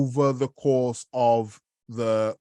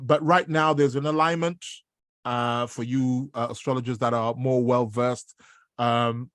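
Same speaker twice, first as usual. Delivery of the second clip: ordinary talk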